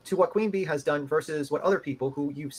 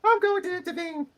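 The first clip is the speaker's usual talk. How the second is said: high put-on court reporter voice; 12 semitones higher than usual. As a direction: play the court reporter, pitched way up. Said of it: "B [name] is doing the court reporter's voice".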